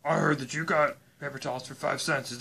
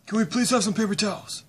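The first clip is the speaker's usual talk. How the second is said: higher, louder eager begging; eager pleading; 7 semitones higher, 5 dB louder than usual. Pretend. Dad calling to the seller, eager higher pleading. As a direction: flag down the seller.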